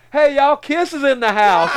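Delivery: high, loud mock waitress call, laughing; waitress announcing. Waitress greets the painted crowd, in a high loud call. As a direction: sing out the welcome like a hostess. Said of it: "some waitress like".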